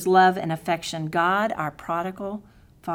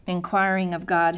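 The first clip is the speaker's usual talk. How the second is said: ordinary delivery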